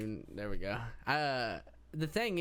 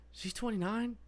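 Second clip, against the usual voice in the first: surprised; 7 semitones higher than usual. Real, not acted. Surprised at actress's age.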